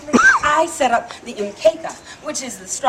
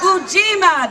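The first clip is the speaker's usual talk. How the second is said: Ujima called higher and louder, a pumped-up lead; 7 semitones higher, 5 dB louder than usual. Shown as a demonstration. After a weak answer, a higher, louder call of Ujima. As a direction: lift the whole crowd with a higher, louder Ujima.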